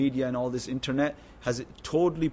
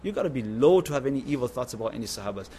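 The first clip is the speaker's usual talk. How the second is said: usual speaking voice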